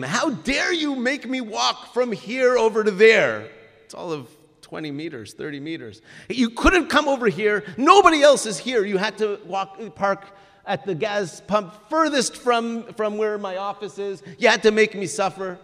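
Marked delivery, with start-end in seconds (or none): 0.0-3.5 high, loud indignant scolding; retold angry yelling. Gas attendant scolds a late-night driver for parking far, angry yell. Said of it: "The gas attendant starts yelling at him". 6.3-15.6 high, loud aggrieved rant; retold angry yelling. Gas attendant berates the driver at the far pump, angry rant. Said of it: "The gas attendant starts yelling at him".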